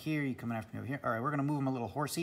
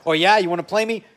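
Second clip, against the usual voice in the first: loud cocky challenge, voice raised; Hellmuth bluster; 5 semitones higher, 11 dB louder than usual. Retold or imitated. Mocking Hellmuth's big money challenge, loud and cocky. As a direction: puff up like a big-talking poker pro. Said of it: "Hellmuth does his patented".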